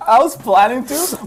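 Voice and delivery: high, loud, slow laughing speech. Real, not acted. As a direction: get the words out through laughter. Still laughing over the failed reach.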